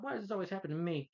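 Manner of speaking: high-pitched imitated lament; mock exasperated whine. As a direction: whine it like a put-upon heroine. Mimics Sue's exasperated whine.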